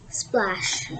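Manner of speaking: "Splash." whispered low and soft; whispered echo. Acted. Echoed softly.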